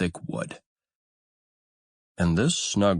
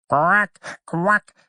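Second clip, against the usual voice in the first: higher, rising duck quack; mother duck quacking; 9 semitones higher, 5 dB louder than usual. A narrator's reading. Mother duck greets her hatchlings with a higher rising quack. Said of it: "said the mother".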